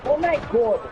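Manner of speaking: sudden high yelp; startled cry in a firefight; panicking gamer. Real, not acted. Gamer getting lasered mid-fight.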